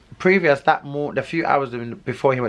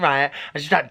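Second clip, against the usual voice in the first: normal talking voice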